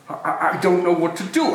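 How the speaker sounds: normal talking voice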